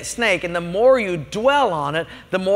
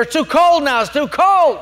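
higher, petulant complaint; irate customer; 7 semitones higher, 5 dB louder than usual. Retold or imitated. Restaurant customer complaining to the waiter, petulant and high. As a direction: whine it like a fussy diner.

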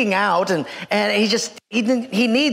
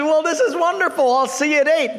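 high-pitched, hammed-up delight; 5 semitones higher than usual. New hire bids boss goodbye, delighted. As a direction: sound thrilled to be hired.

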